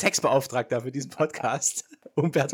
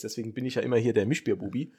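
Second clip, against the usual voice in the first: ordinary delivery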